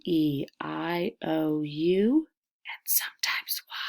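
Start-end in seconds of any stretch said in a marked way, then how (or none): 1.2-3.8 whisper. Shown as a demonstration. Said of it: "our vowels are".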